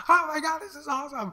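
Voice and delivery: very high, loud excited shriek; freaking out, yelling. Retold or imitated. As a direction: imitate an overjoyed dealer screaming. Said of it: "freaking out and yelling".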